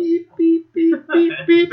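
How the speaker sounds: high, even reversing-alarm beeps; imitated reversing beeps. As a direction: mimic a truck's backup alarm up high. Imitates a vehicle backing away.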